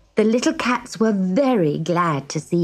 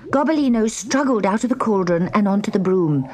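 ordinary delivery

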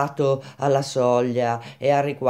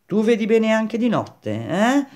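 high, jeering question; mocking; 9 semitones higher, 4 dB louder than usual. A narrator's reading. Baldini jeering at Grenouille's night sight, mocking. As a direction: taunt with a raised, needling "eh?". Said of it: "lo schernì Baldini".

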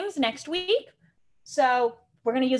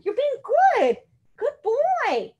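much higher and louder, sing-song praise; 11 semitones higher, 3 dB louder, slower than usual. Shown as a demonstration. Trainer praising the puppy in a high, happy voice. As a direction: gush praise at the dog. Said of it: "I'm gonna tell him".

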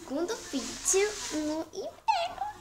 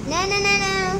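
loud quick no no no; louder, cry out in alarm; alarmed shout; 5 semitones higher, 7 dB louder, faster than usual. Real, not acted. Hand gel goes wrong.

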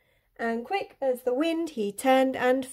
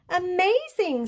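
higher-pitched exclamation of wonder; amazed; 8 semitones higher than usual. A narrator's reading. Gruffalo sees the owl flee, amazed. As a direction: let the voice lift in amazement.

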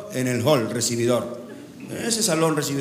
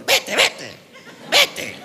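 high squawked parrot interjection; 7 semitones higher, much slower than usual. Acted. Parrot shooing its owner's friend away, high squawk. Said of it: "El loro ya agarra con la chivichanga y le dijo".

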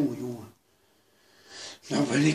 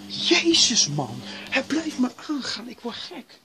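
high, loud frantic outburst; exasperated yell; 11 semitones higher, 7 dB louder than usual. Real, not acted. Player driven mad by the beeping alarm, yelling high. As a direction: lose it at the nonstop alarm.

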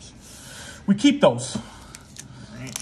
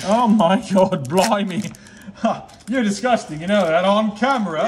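laughing disgust; 5 semitones higher, 4 dB louder than usual. Real, not acted. Reacts to a fart, laughing.

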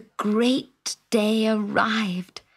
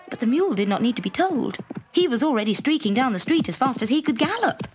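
usual speaking voice

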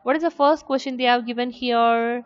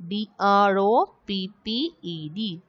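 letter by letter, evenly spaced; spelling out letters. Shown as a demonstration. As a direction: spell each letter out separately. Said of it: "the spelling of the word dropped".

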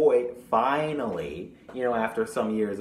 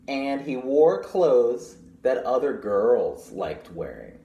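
slow, louder storytime read; reading to children; 5 dB louder than usual. Acted. Librarian reading the picture book to children, storytime voice. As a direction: read it out to the class, clear and unhurried.

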